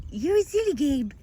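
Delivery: high sing-song coo to a sheep; high cooing pet voice; high pet-talk voice. Real, not acted.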